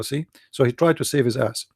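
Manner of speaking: usual speaking voice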